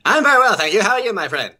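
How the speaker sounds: high, loud propaganda announcer voice; north korean newsreader. Acted. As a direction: beam it like state TV. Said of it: "this freaking propaganda voice".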